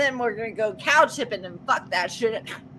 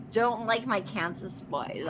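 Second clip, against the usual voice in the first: ordinary delivery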